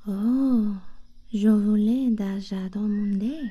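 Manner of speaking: normal talking voice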